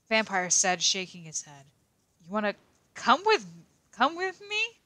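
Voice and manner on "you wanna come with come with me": much higher and louder, voice cracking up; nervous squeaky invite. Acted. Vampire shyly inviting her to the concert. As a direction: stammer it out high and flustered. Shy boy asking her out.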